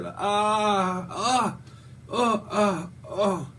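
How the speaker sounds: imitating sciatica groans; mock pain groans. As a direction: groan like a bad back twinges. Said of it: "it sounds like me with my sciatica".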